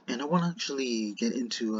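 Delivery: ordinary talk, nothing marked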